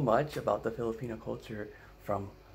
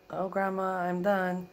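exasperated; 9 semitones higher, 4 dB louder, slower than usual. Retold or imitated. Grandson pleading with grandma.

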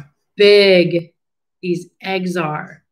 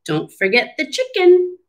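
Pete's voice; 7 semitones higher than usual. Acted. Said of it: "says Pete".

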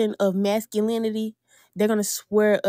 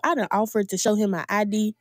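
ordinary delivery

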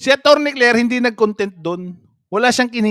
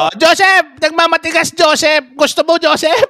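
raised, higher mocking echo; mock confrontation; 6 semitones higher, 4 dB louder than usual. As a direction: throw the challenge at Joseph in mock outrage. Mockingly echoes clip's threat to Joseph.

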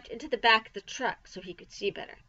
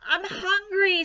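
put-on boy's complaint; 11 semitones higher, 4 dB louder, slower than usual. A narrator's reading. Boy whining that he's hungry.

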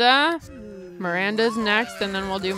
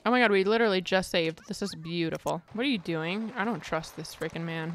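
normal talking voice

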